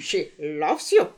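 slow laughing mock German accent; bad German accent, laughing. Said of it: "the bad, uh, German accent".

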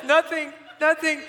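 high-pitched, tearful squeal; mock crying kids. Crying kids answering their leader, high mock whimper. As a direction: imitate kids denying it through tears. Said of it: "like, crying".

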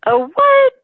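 high-pitched, startled comeback; mock incredulous. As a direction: shoot the pitch way up in acted disbelief. Acts out her baffled reaction.

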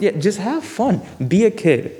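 excited. Real, not acted. Excited playful exclamation.

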